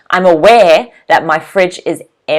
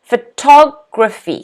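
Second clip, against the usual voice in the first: slow, divided "Photography", TOG stressed; stress on second syllable; 3 dB louder than usual. Shown as a demonstration. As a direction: say "Photography" in parts, punching pho-TOG. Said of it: "stress is on the second syllable".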